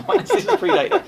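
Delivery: fast, laughing correction ending in a squeak. Retold or imitated. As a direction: laugh it out quickly, voice jumping up high. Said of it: "It's like".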